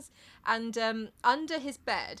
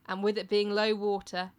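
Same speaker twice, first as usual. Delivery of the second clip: ordinary talk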